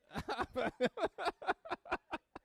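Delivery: loud high laughter; real amused laugh. Real, not acted. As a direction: laugh at your own joke. Laughing at own joke.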